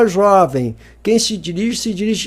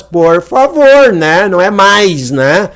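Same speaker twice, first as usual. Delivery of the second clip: exasperated plea; 6 dB louder, slower than usual. Real, not acted. Teacher exasperated at wrong answer.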